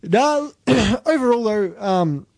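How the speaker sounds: strained, raspy voice that keeps catching; a hoarse voice that is giving out; losing voice. Real, not acted. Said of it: "sorry, I'm losing my voice".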